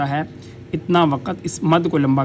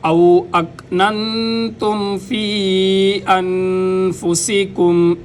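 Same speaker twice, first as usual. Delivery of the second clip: slow melodic tajweed chant; reciting the verse in full after the lesson; Quranic recitation; 3 semitones higher, much slower than usual. Acted. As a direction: draw each vowel out as in recitation.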